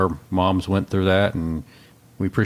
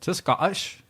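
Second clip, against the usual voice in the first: higher, softer mock-Scottish accent; bad Scottish accent; 7 semitones higher, 4 dB quieter, slower than usual. Host greets a Scottish caller, badly faked Scottish accent. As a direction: have a playful go at a Scottish accent.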